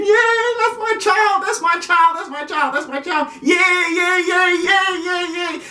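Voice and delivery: high, squealing mock cheer; cheering parent. Parent cheering their child at the game, high mock cheer. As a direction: ham up the proud parent in the stands.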